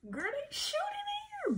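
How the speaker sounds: put-on a scared girl telling her sister; freaking out. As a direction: squeal it in alarm. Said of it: "I was freaking out".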